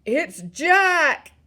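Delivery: high, loud terrified bellow. A narrator's reading. Nightmare-stricken giant spotting Jack, bellowing. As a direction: bellow it at full voice. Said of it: "bellowed the flesh lump eater".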